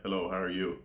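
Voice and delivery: hushed, low greeting; low-pitched greeting. Shown as a demonstration. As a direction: keep the voice low and calm so you seem respectful. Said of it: "toning my voice, way low".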